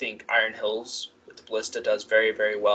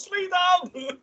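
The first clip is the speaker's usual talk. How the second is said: very high, drawn-out mock-Scottish cry; Scottish battle cry. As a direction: wail it like a Scottish clansman charging into battle. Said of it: "run it with a Scottish accent".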